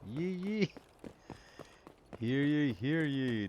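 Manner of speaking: drawn-out hear ye call; town crier. Pretend. Calling out to check mic.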